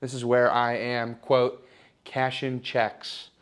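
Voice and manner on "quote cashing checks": slow. Acted. Air-quote joke.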